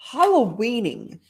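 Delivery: ordinary delivery, nothing marked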